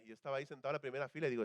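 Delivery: normal talking voice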